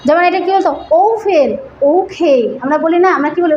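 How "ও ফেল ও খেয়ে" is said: ও খেয়ে as oʊ-khei, rounded O and puffed K; corrected pronunciation. Shown as a demonstration. Trainer applies the new letters to words, careful glides. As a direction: glide the O, then aspirate "খেয়ে". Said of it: "ওকে না, তাহলে ও খেয়ে".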